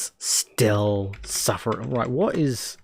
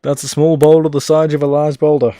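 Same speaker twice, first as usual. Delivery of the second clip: louder, triumphant declaration; triumphant shout; 4 semitones higher, 11 dB louder than usual. Real, not acted. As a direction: belt it out in triumph. Finally gets the sentence out.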